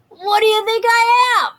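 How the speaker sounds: tough tooth fairy. A narrator's reading. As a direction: snap back like a tough biker.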